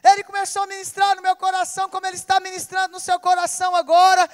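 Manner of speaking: fast, loud proclamation to the church; full-force preaching. Acted. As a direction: drive it home over the congregation. Preaching to the congregation. (pregação inflamada e rápida)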